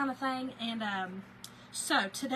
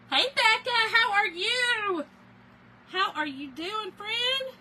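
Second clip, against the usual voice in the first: excited greeting; 11 semitones higher, 9 dB louder, slower than usual. Real, not acted. Greeting a viewer in chat.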